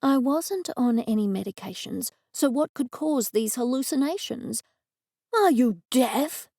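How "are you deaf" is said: slow, louder sneer from a cat; a cat mocking someone's hearing; a cat snapping at a slow human; scornful cat. A narrator's reading. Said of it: "the cat said".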